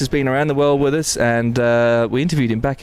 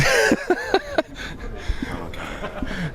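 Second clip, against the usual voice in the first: squealing high laugh; high giggling laugh; 6 dB quieter than usual. Real, not acted. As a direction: giggle it out, caught out. Laughs after being shushed.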